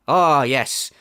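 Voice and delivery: ordinary delivery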